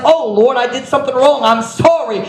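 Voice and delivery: loud, high cry of confession; fervent repentance. Acted. Acts out convicted sinner repenting.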